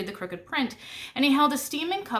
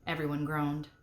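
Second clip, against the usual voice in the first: ordinary delivery, nothing marked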